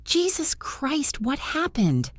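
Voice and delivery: high, slow shocked gasp; stunned at the sight of her; alarmed friend on seeing her. A narrator's reading.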